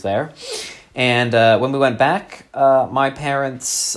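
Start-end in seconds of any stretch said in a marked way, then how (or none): none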